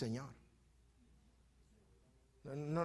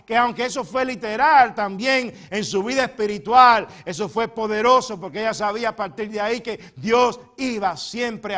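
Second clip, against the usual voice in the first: loud, higher preaching; full-force preaching; 6 semitones higher, 15 dB louder than usual. Acted. Preacher exhorting the congregation, loud and high. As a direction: proclaim it to the whole church.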